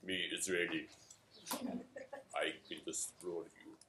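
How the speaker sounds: lightly voiced caveman tough guy; macho Israeli. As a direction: play a cartoon macho threatening an enemy. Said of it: "You have to be a little bit like".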